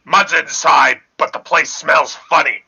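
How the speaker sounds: ordinary talk, nothing marked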